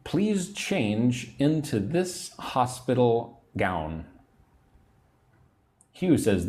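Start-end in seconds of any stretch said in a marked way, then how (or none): 0.0-4.0 1 slow model sentence. Shown as a demonstration. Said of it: "Number twelve".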